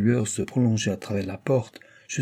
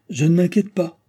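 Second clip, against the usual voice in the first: forceful outburst, much higher; out loud; 7 semitones higher, 4 dB louder than usual. A narrator's reading. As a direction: cry it out to steady herself. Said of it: "dit-elle tout haut".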